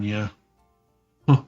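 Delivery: ordinary talk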